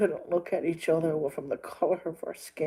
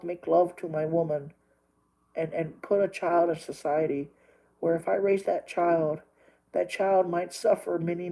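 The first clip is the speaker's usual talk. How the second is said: ordinary delivery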